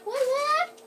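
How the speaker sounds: high loud whooping; excited whoop. Real, not acted. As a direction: whoop with glee. Cheering again in game.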